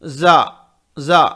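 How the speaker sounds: जा with the front teeth resting on each other; Za letter. Shown as a demonstration. Teacher showing students the letter जा, teeth together. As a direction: say जा through the closed front teeth, not stretched. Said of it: "सामने के दांत एक दूसरे के ऊपर रखेंगे".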